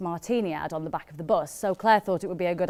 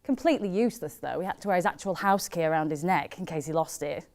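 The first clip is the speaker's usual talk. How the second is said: ordinary talk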